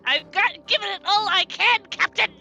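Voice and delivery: bad Scottish accent. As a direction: shout it like a hammy Scottish engineer. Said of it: "a very bad Scottish accent".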